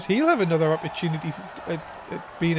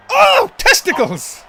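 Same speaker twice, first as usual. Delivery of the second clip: high shocked outburst; shocked yell; 12 semitones higher, 9 dB louder, slower than usual. Real, not acted. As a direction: blurt it out in shock. Commentator reacts to low blow.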